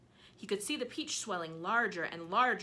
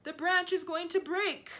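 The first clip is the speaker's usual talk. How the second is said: loud alarm; 10 semitones higher, 8 dB louder than usual. Acted. Aunt fearing branch breaks.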